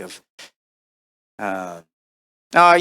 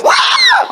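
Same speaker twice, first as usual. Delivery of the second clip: very high, loud falling shriek; piercing creature scream; goblin guinea pig scream; 8 dB louder than usual. Shown as a demonstration. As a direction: squeal like a goblin plunging off a cliff. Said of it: "just screaming goblin guinea pig".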